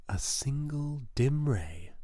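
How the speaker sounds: ordinary delivery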